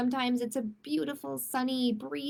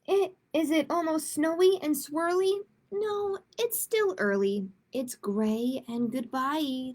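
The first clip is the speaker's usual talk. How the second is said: lively rhyming read-aloud; 2 semitones higher than usual. A narrator's reading. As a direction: read each rhyme like a game for kids. Reads a rhyming picture book.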